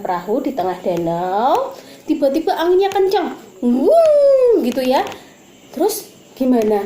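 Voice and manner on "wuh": loud, high whoosh of the wind. Retold or imitated. Teacher making the storm wind sound for the kids. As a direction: blow out a gust like a storm.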